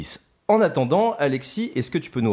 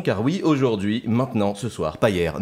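ordinary delivery

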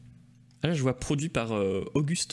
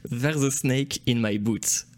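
slow, careful English accent, louder; 5 dB louder, slower than usual. Shown as a demonstration. Host reads the song title live on air in a slow English accent. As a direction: spell out each English word in an English accent. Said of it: "avec mon accent anglais".

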